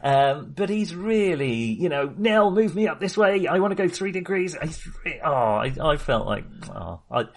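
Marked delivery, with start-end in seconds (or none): none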